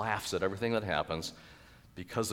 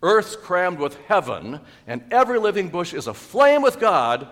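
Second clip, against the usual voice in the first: poem recitation; 9 semitones higher, 10 dB louder than usual. Acted. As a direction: proclaim the verse with soaring force. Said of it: "reminded me of Elizabeth Barrett's Browning's".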